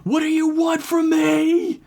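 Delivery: high, slow, desperate shriek; terrified scream. Acted. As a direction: scream it out against the noise. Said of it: "screaming at the top of her lungs".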